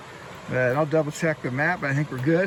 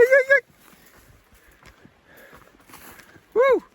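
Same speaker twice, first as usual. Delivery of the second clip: scaring off a bear. Real, not acted. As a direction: shout high to warn bears off. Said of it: "been doing a lot of whoop whoop".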